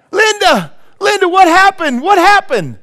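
very high, loud, strained cries with a laugh at the start; frantic wailing. Retold or imitated. Punchline patient shrieking for Linda, very high and loud. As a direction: shriek the name in comic despair.

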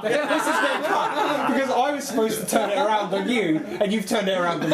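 high-pitched, laughing outrage; laughing protest. Real, not acted. Co-host turning the joke back on him, laughing protest. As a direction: protest through helpless laughter, voice pitched right up.

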